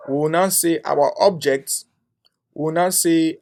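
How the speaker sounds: ordinary talk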